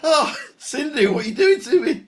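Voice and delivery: very high, moved exclamation with a laugh; real emotional outburst, pitch soaring; overcome with emotion. Real, not acted. Host overcome by touching story.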